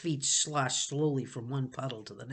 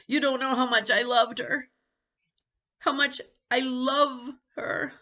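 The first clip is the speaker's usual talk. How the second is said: higher, louder tearful plea; 10 semitones higher, 6 dB louder than usual. Acted. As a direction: plead through tears. Jonathan grieving in tears.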